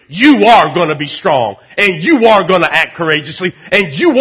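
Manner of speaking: loud, driving preacher's push; rally them, full voice; full-force preaching. Acted.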